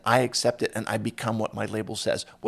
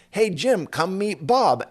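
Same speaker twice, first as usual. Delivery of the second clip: calling out; 8 semitones higher, 5 dB louder, slower than usual. Retold or imitated. Calling a friend over.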